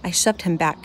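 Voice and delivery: usual speaking voice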